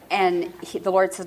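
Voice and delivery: ordinary talk, nothing marked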